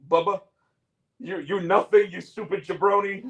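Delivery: lightly put-on wrestler insulting a rival; Iron Sheik. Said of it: "I think the Iron Sheik would have said".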